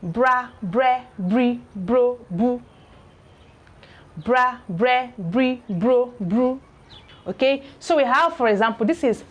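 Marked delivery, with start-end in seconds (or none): 0.0-2.6 bra bre bri bro bru, each with a rolled R and no L; teacher modelling the R sound; R blend demo. Shown as a demonstration. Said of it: "Re, not the li". 4.2-6.6 bra bre bri bro bru, each with a rolled R and no L; teacher modelling the R sound; R blend demo. Shown as a demonstration. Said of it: "Re, not the li".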